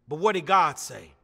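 low, soft, searching question; inner voice asking. Acted. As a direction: drop down low and ask it quietly. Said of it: "there's a voice asking you".

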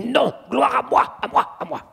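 put-on devil voice, insistent and easing off; demon's retort. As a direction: play the demon claiming glory for itself. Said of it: "Il dit".

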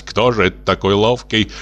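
usual speaking voice

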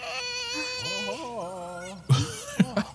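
shrill delighted laugh climbing up high; laughing high at the creature; high squealing laugh. Real, not acted. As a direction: let out a squealing giggle. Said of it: "He's, like, mocking him".

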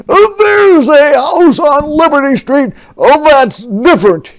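loud, high outraged bellow; angry roar. Acted. General roaring from his window, outraged. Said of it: "he roared from his window".

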